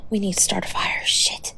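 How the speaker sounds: hushed, quiet worry; urgent whisper. Real, not acted. Planning a fire for the freezing partner, whispered. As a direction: mutter the plan under your breath.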